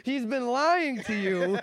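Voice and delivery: high, slow, put-on voice; mock dramatic reveal. Reveal to the others that John lies, in a high slow voice. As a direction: stretch it out like a big secret. Teasing reveal about friend's name.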